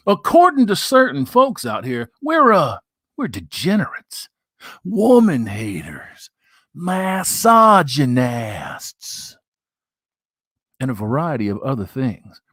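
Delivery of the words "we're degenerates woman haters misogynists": ordinary talk, nothing marked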